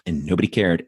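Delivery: usual speaking voice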